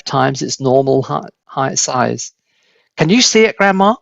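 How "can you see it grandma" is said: high, loud calling up; boy calling to grandma on the roof; shouting. A narrator's reading. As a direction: shout up to her. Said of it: "George shouted".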